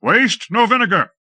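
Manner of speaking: high, loud shouted order. A narrator's reading. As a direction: shout across the field. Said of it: "shouted Lord Fayed".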